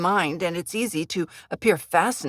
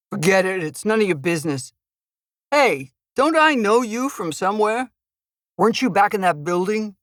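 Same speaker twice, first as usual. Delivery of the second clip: gruff thug voice; 2 semitones higher than usual. Acted. Thug character voice.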